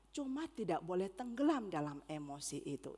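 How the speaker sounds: normal talking voice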